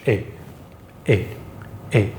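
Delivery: crisp, short "A" vowel; French e-acute, cut short; sharp French é. Shown as a demonstration. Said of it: "sharp sound".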